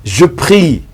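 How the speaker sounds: usual speaking voice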